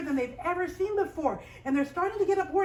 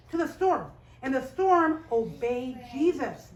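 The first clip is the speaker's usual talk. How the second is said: plain reading voice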